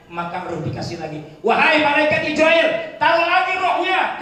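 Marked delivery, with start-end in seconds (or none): 1.4-4.2 seruan tinggi dan keras kepada malaikat; lantang memanggil malaikat. Acted. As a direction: panggil malaikat dengan suara lantang. Preacher voices plea to angel. (seruan khotbah yang tinggi dan keras)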